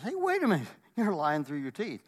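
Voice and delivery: high, loud mock protest; indignant calling out. Imagined objector calling out someone lying, high loud indignant outburst. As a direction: jump up high on the first words, then ease down.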